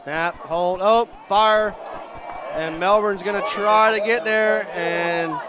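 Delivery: building excitement up to a near shout; excited call. Real, not acted. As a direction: call the broken play as it happens. Live call of a botched kick.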